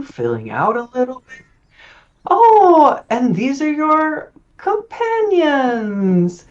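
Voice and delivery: very high, gushing and theatrical, air kisses and all; flamboyant cleric voice. Acted. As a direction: play her as an over-the-top socialite priestess.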